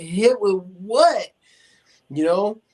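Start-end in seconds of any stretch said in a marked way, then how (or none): 0.0-1.3 slow, loud, stretched disbelief; bewildered question. Retold or imitated. Husband answering his wounded wife, slow and loud in disbelief. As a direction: drag each word out in confusion.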